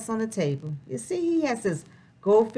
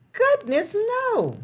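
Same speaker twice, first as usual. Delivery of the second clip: cheerful exclamation; 11 semitones higher than usual. Acted. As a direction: toss it off happily, no worries at all. Answering "does Pete worry.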